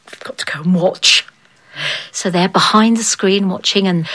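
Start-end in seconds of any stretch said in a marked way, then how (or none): 0.1-1.2 whisper. A narrator's reading. Queen whispering to maid.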